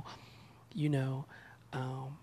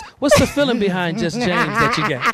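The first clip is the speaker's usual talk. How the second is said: mock host voice, louder, laughing through it; playing interviewer Meech; 8 semitones higher, 15 dB louder than usual. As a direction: play the interviewer, hamming it up. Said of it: "let me be Meech for a minute".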